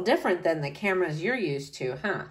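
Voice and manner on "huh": usual speaking voice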